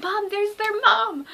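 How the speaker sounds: high, loud, drawn-out cry to mom; excited freak-out. Retold or imitated. Fan spotting their idols' mom, high excited squeal. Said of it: "freaking out".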